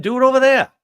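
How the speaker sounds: loud, high-pitched brush-off; exasperated shout to go elsewhere; dismissive retort. Acted. Acted retort to a foolish critic.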